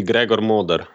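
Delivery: "Gregor Moder" said the Slovenian way; Slovenian pronunciation. Shown as a demonstration. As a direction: pronounce "Gregor Moder" as in Slovenian, kind of like German. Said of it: "the Slovenian pronunciation would be".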